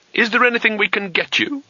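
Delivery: high, anxious offer. Acted. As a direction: ask eagerly and worried.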